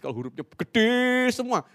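"gede" stretched out high and loud; penekanan pada "gede". Shown as a demonstration. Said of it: "itu artinya penegasan dan marah". (kata "gede" dipanjangkan, tinggi dan lantang)

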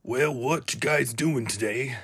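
put-on cartoon character greeting; Pete. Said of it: "it kinda sounds like that".

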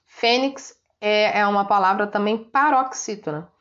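ordinary talk

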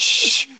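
loud, long "shh"; exaggerated loud shushing; mock hush. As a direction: shush them in pretend alarm. Mock hushing over forbidden name.

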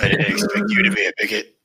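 high, loud ghoul voice. Acted. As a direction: sneer it like a Fallout ghoul. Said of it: "Plus they say bigot".

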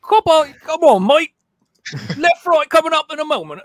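put-on over-the-top Australian accent, high and loud. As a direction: squeak out a bad Australian sat-nav. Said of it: "Can't even do it".

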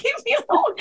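high whiny plea to be left alone; hammed-up high whine; mock pleading. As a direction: beg it to stop, half joking.

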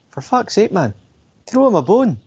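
high, drawn-out exasperated pleading; exasperated plea. Real, not acted. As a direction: beg in high disbelief. Exasperated plea about Ospreay.

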